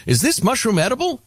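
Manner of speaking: high-pitched meme character asking; curious question, acted. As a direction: ask in a lightly put-on high voice. Man in meme asks AI.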